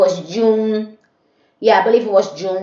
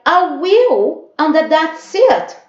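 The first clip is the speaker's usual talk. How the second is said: defiant retort; 11 semitones higher, 4 dB louder, much slower than usual. Retold or imitated. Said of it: "I was like".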